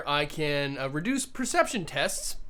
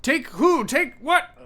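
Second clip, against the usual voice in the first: confused robot; 8 semitones higher, 3 dB louder than usual. Acted.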